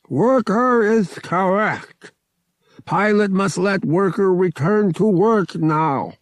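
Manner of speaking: high, loud and slow, each word stiff and heavy; a big alien guard laying down the rule; Togorian guard voice. Acted. Togorian guard telling the pilot to let the worker go, high and loud.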